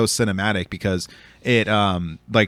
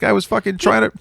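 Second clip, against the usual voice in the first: much higher, exasperated protest; indignant retort; 11 semitones higher, 6 dB louder, faster than usual. Retold or imitated. Answering friends who asked why he pushed the guy, in a high indignant voice. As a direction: defend yourself to your friends, worked up.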